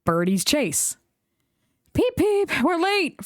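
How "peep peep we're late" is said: high fussy engine voice. A narrator's reading. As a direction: fuss and fret, pitched high. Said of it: "fussed Edward".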